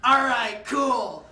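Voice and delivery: high, slowed shouting into the phone; brash yelling order. Retold or imitated. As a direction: boss the escort line around. Hack calling escort service, demanding.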